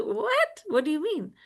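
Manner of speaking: high-pitched, incredulous "what"; mock astonishment. Mother hears son won't study, acted high-pitched astonishment. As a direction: act out a mother caught completely off guard. Mother startled by son's conclusion.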